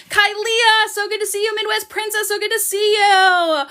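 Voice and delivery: high-pitched gushing welcome; delighted greeting. Real, not acted. Host greets arriving viewers, delighted. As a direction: greet them in a high, excited voice.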